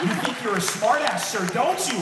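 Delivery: loud, in-your-face mock challenge; confrontational cop. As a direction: imitate an officer squaring up to someone. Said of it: "he started getting in my face".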